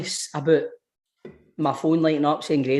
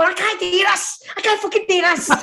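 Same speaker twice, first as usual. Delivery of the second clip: panicked squeak. Retold or imitated. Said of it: "I go".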